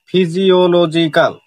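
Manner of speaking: ফিজিওলজিক্যাল slowly broken into its syllables; slow teacher's demo, ফি-জি-ও-ল-জি-ক্যাল. Shown as a demonstration. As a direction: sound out ফিজিওলজিক্যাল part by part. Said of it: "ভাগ ভাগ হয়ে উচ্চারণ".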